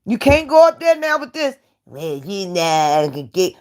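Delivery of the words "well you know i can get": soft, hesitant mock voice of a rival; mocking a stammer. Mocking a fumbling rival on her live stream, soft and unsure. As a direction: fumble the words like a nervous guy.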